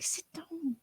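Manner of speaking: hushed, whispered command; soft in-character whisper; whispered remark. Acted. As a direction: whisper it under your breath. Player voices character's muttered remark.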